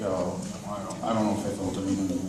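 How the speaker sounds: low, soft, unconvinced mumble; quietly unsure, holding back; hesitant doubt. Retold or imitated. Teenager tells the praying men he felt nothing, quiet doubt. Retold kid doubts the prayer worked.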